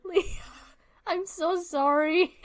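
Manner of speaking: slow, high, laughing sorry; laughing apology. Real, not acted.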